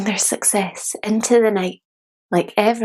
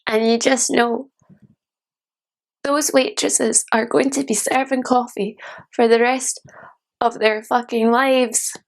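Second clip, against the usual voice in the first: higher-pitched, mounting bitterness; bitter disillusion; 4 semitones higher than usual. Acted. Dreamer sees failed waitresses and sours, bitterly disillusioned. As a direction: let the disillusion push the voice up.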